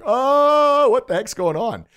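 high, loud drawn-out "Oh"; imitating a startled guest at the microphone; hammed-up alarmed outburst; mock mic shock. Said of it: "they get behind a microphone like".

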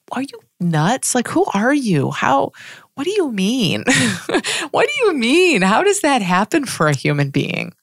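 high, bewildered "what do you mean?" with a laugh; incredulous disbelief. Retold or imitated. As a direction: play it as utterly baffled by the idea. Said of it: "being like".

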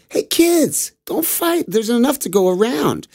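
high, sing-song telling-off; parents hushing the kids. Acted. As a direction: soothe two squabbling children. Parents stop the kids fighting.

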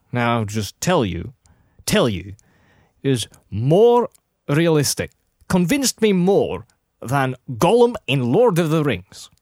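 usual speaking voice

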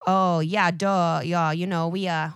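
a light put-on old New York newsie. Podcaster imitating a Brooklyn newsie for her cohost. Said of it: "this is my Conan O'Brien doing a newsie".

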